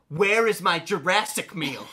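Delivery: put-on teen repeating the demand to his brother, pushier; repeated demand. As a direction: spell it out impatiently. Repeats the demand, more pointed.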